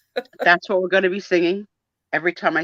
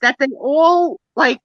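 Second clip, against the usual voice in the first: mock baffled European; 6 semitones higher than usual. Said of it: "Europeans must be like".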